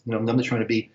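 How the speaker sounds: ordinary talk, nothing marked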